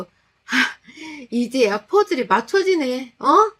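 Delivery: high, loud furious accusing; angry accusation. Retold or imitated. Wife confronting mother-in-law, high furious shouting. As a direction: yell the accusation at her. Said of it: "전 울었어요".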